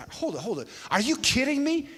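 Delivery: high, incredulous comeback; indignant disbelief. Retold or imitated. Husband answering his university's offer of leave, indignant disbelief. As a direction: throw it back in disbelief.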